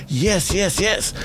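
high, rapid-fire mock audience yeses; eager agreement. Acts out eager audience answering.

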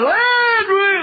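high, loud shout across a noisy church; screaming a name. Retold or imitated. Preacher screaming for Landry over the worship. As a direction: yell the name over the crowd. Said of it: "he screamed for a man named Landry".

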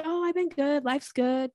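lightly voiced bland reply; dull brush-off. Shown as a demonstration. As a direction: shrug off the question. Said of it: "versus just saying something like".

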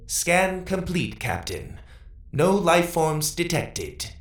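high, slow robotic intercom voice; intercom report. A narrator's reading. Intercom reporting scan results to the captain, robotic. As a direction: report in a high, drawn-out machine voice.

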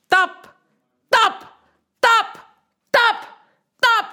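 high, loud pounding tup; voice of the ocean. Acted. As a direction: beat out the waves. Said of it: "And the ocean said".

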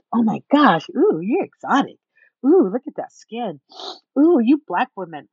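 loud, swooping mock fascination; fetishizing. Later boyfriend fetishizing her skin, mock gushing. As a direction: gush with leering oohs, imitating him. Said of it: "He was more fetishizing".

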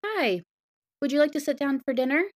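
high, careful politeness to a guest; polite host dad. Acted. Dad greeting a strange superhero, politely. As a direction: play the awkwardly courteous father.

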